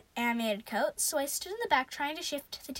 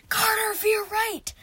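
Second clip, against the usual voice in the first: high, loud, urgent scream; panicked, drawn-out yell; yelling a warning; 9 semitones higher, 8 dB louder, slower than usual. A narrator's reading. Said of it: "Sadie yelled".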